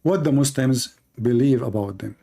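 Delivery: usual speaking voice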